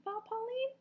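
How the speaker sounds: ordinary delivery, nothing marked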